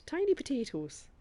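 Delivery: put-on Irish accent; Irish catchphrase. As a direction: toss out a fond mock-Irish saying. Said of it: "We used to say".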